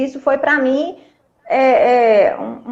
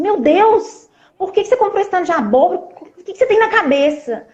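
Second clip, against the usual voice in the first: higher, fast, pretend exasperated outburst; exasperated scolding; 6 semitones higher than usual. Wife pretending to scold husband over the pumpkins, exasperated. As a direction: snap at him as the urge says, higher and fast. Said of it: "xingar, né?".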